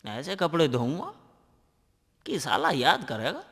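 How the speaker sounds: quiet scheming aside; muttered aside. Acted. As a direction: mutter it softly, plotting. Boasting to himself after boss leaves.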